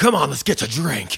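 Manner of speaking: high, loud mock rowdy old man; old party animal. Party animal pushes in on the birthday man, excited holler.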